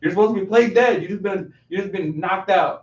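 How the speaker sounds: loud, high mock scolding; mock exasperation. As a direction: imitate someone indignantly telling off a clumsy partner. Acting out annoyed wrestler's scolding.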